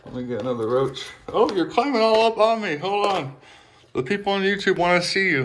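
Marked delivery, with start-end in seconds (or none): 1.3-5.4 high, playful pet-owner voice; fond high-pitched fussing; pet talk. Real, not acted. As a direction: chat to a clambering little pet, pitched up high. Talking to climbing lizard.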